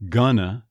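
"gonna" as "GUN-uh"; contracted form. Shown as a demonstration. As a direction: squash "going to" into "gonna". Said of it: "many Americans will change it to".

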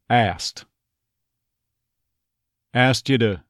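"Asked" said "ast" with no K; dropped K. Shown as a demonstration. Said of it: "K there may feel like it's completely gone".

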